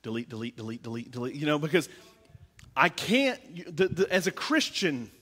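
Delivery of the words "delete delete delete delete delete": muttered deletes. Retold or imitated. Deleting posts.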